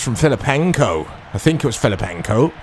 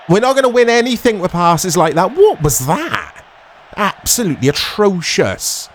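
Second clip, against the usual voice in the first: exasperated; 6 semitones higher than usual. Real, not acted. Frustrated rant at bad pass.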